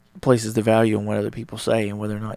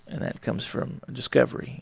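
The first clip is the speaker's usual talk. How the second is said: normal talking voice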